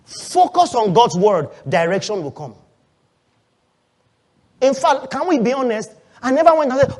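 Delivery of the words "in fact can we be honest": usual speaking voice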